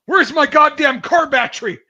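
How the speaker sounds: put-on loud, high furious yell at a kid; retold angry yell. Great uncle bursting into the ice house, yelling at the boys. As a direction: bellow it like an enraged great uncle.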